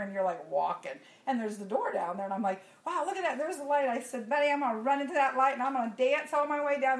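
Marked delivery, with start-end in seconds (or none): 2.8-3.9 high, loud burst of wonder; excited exclamation. Retold or imitated. Woman spotting the light down the long hall, excited. Acting out playful moment in hallway. 4.3-7.0 loud, high, playful announcement; giddy excitement. Retold or imitated. Woman joking with Betty in the long hall, giddy. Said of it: "And I'm laughing".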